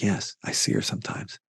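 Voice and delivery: whispered, barely voiced; hushed whisper. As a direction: breathe it out as a secret confession. Guide quietly confirms seeing the ghost.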